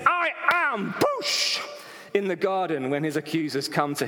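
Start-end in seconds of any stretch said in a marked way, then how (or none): 0.1-0.9 high, forceful proclamation as Jesus; emphatic divine declaration. Acted. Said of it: "And so Jesus says". 0.9-1.6 high, explosive "boosh"; vocal whoosh of an impact; imitated blast. Imitates blast of divine power.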